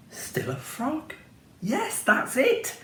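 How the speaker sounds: slow, high, hushed realisation; dawning idea. A narrator's reading. Prince quietly realising his escape, slow and hushed. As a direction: let the idea dawn slowly, almost to himself. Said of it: "The prince thought".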